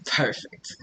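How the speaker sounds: breathy whisper of delight; whispered approval. Retold or imitated. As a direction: whisper it like a pleased spirit. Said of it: "spirit guides being like".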